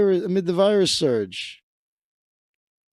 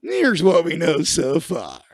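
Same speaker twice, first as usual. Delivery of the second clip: slow, drawn-out parody of the reporter; mocking imitation. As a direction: repeat his line slowly and mockingly. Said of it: "mocking the reporter".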